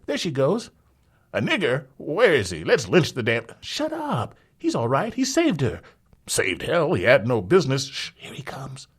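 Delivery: each voice jumps in eagerly; excited crowd. Acted. Gossiping crowd argues over the survivors, loud crowd chatter.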